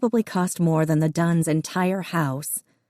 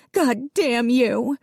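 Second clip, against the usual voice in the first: higher, slow, tearful rage; tearful outburst; 8 semitones higher, much slower than usual. A narrator's reading. Missus Dunn cursing the family, tearful and higher. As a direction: curse them through tears. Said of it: "tears leaking out of her eyes".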